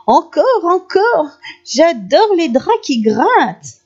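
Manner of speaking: high, gleeful exclaiming; delighted cry. A narrator's reading. Grandmother feigning joy at crumbs in bed, exclaiming. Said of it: "qui s'écria".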